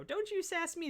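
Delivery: high mock scolding of a dog; scolding owner. Owner scolding a sassy dog, mock stern. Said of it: "when their owner tried to go".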